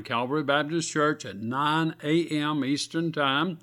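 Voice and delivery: ordinary talk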